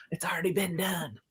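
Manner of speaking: a hushed, knowing aside; whispered aside. Real, not acted. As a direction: whisper it softly, like a secret. Quiet punchline after laughing.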